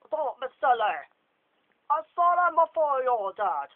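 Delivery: loud, high booming teacher voice; confident. Acted. Lockhart to James, loud confident dismissal. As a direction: dismiss him with full confidence. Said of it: "said Hark with confidence".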